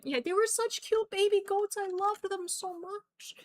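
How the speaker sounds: high-pitched squeaky cooing; adoring gush. Real, not acted. Streamer remembers cute baby goats, high adoring coo. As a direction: gush over something adorably cute.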